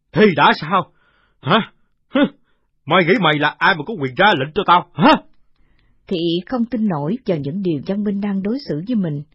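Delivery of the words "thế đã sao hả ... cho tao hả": loud, sneering villain voice; cruel old villain. Acted. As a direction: taunt her with contempt. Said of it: "Lão cười hờn hờn ... ác độc".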